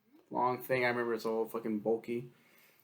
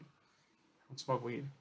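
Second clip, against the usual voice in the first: slow, softer deadpan denial; flat retort; 9 dB quieter, much slower than usual. Retold or imitated. Denying to the stranger in a slow, soft deadpan. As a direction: say it slow and dry. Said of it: "I'm like".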